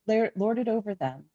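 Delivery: ordinary talk, nothing marked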